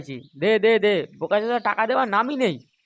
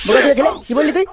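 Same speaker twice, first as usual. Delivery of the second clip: angry outburst; 5 semitones higher, 5 dB louder, faster than usual. Acted. Uncle enraged at boy's insult.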